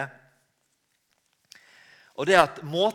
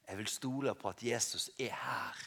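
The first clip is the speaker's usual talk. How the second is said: whisper, much softer, intimate; whispered prayer; 8 semitones lower, 10 dB quieter than usual. Shown as a demonstration. Preacher showing a listener praying at home, whispered. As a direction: breathe it like a private prayer. Quiet personal prayer on chair.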